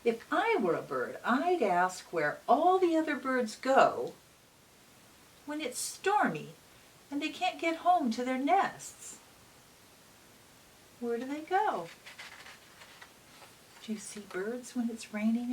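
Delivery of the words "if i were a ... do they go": bright, lively read-aloud voice; reading to children. A narrator's reading. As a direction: read it like storytime for little kids. Reads picture book aloud.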